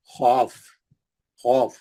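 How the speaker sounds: खौफ low, with a rounded, held "aw"; thick. Shown as a demonstration. Teacher lists the heavy letters for students, thick and low. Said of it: "मोटा करने के लिए मुंह गोल करना".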